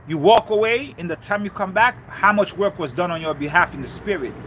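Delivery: ordinary delivery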